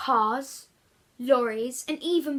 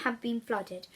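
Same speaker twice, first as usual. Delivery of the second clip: plain reading voice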